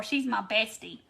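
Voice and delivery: normal talking voice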